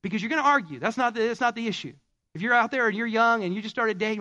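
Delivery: ordinary delivery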